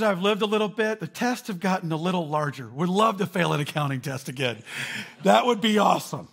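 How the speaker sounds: high, loud wry sarcasm; mock rueful sarcasm. Pastor voicing a tired adult, high loud sarcastic. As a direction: sigh it out with irony.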